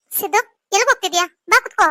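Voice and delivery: calling out. Acted. Mother calling son to sit.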